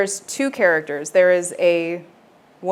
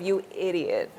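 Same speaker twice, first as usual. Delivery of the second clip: "you idiot" tone; 3 dB quieter than usual. Shown as a demonstration. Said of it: "you just have to have that tone of".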